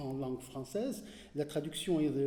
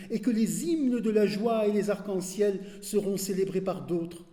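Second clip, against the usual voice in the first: impassioned poem recitation; 5 dB louder than usual. Acted. Reader reciting Darwish's last prayer, impassioned poetry declamation. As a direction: declaim in long driving phrases, easing at each close.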